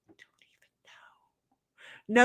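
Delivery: slow breathy whisper, no voice at all; whispered aside. Acted. Streamer whispering an aside to her viewers, hushed. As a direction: drop to a secret whisper to the audience.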